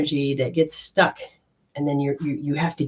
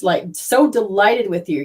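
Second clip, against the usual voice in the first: usual speaking voice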